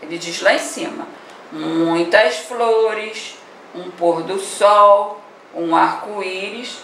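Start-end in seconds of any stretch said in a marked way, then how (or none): 1.5-5.2 very slow, drawn-out words; slow dictation. Real, not acted. Teacher recaps the answer for pupils to write, dictating slowly. 5.5-6.8 slow, stretched-out list item; slow dictation. Real, not acted. Teacher lists the poem's items for pupils, dictating slowly.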